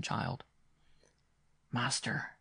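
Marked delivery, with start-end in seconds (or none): 1.7-2.4 soft, hushed call; whispered. A narrator's reading. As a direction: murmur gently to a sick boy. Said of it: "he whispered".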